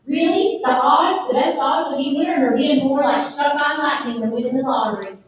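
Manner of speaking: hammed-up Southern news read. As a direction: imitate a green newscaster with a heavy Southern accent. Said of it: "I sounded like such a Southern hick".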